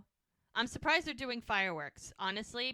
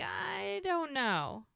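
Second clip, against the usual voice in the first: high, long-stretched mock cluelessness; drawn-out don't know; 10 semitones higher, much slower than usual. She answers him atop the Ferris wheel, drawn-out and high. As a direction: stretch it out high, like a singsong shrug.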